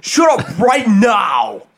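high, loud frantic yell. Retold or imitated. Friend yelling back at the prank, loud and high. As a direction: yell it like you can't take it. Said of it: "he'd be like".

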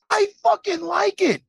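high, loud defiant yell; mock defiant shout. Imitating the fighter doubling down, mock defiant shout. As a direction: belt it out like a cocky fighter. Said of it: "He doubles down on it".

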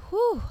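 high, loud exhale of relief; a loud, high-pitched sigh of relief; relieved whew. Pretend. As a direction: blow out a big high whew.

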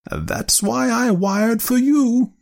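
high, slow, decisive aunt; decided. A narrator's reading. Aunt answering her nephew's objection, decidedly. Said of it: "she said with decision".

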